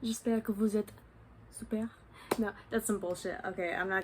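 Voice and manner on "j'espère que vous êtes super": French video intro, lifted pitch at the start; French-language greeting. Acted. Greeting viewers in French as a joke intro, performed French. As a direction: open in French as if hosting.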